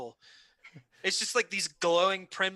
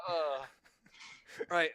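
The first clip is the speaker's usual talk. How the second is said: normal talking voice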